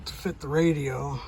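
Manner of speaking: ordinary talk, nothing marked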